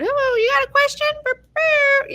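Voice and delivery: very high squeaky helper voice; cartoon paperclip voice. Microsoft paperclip helper popping up, very high squeaky voice. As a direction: pipe up like a cheerful cartoon clip. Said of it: "and he would".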